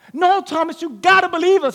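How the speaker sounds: high, urgent plea; pleading insistence. Retold or imitated. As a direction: beg him to believe you. Disciples pleading with Thomas.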